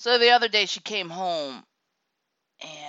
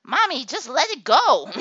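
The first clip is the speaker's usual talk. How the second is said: put-on child's voice; 8 semitones higher, 7 dB louder than usual. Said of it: "the kid said".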